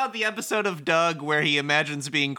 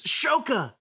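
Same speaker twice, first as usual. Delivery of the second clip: alarmed call; 12 semitones higher than usual. Acted. Voices Rindo crying out to Shoka.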